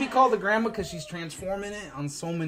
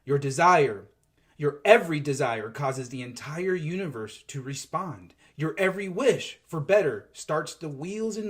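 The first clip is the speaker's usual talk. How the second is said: inspirational reading; 5 semitones lower than usual. Acted. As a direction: read it like a blessing to the listeners. Reads channelled spiritual post.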